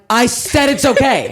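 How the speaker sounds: high, drawn-out retort; mock exasperation. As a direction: stretch it out, fed up on someone's behalf. Voices the other's exasperated reply.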